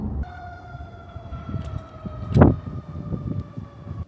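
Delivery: airy high giggle; unvoiced squealing laugh; delighted breathless laughter at the dog. Real, not acted.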